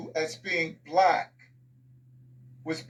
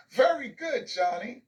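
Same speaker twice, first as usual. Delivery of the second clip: higher-pitched gushing teacher praise; celebratory praise; 5 semitones higher than usual. Acted. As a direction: make a big deal of the pupil's answer. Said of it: "It was celebrated".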